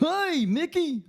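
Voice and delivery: high Goofy "Hey, Mickey"; Goofy impression. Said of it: "You sound like a fuck".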